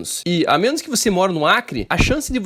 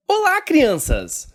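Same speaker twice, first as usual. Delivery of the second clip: put-on kids show host; 9 semitones higher, much slower than usual. Host greets viewers theatrically.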